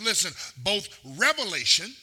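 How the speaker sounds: ordinary delivery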